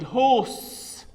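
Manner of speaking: HORSE with silent r and long aw; correct pronunciation. Shown as a demonstration. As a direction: model HORSE slowly as "hawss". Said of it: "be correct about the pronunciation of the word".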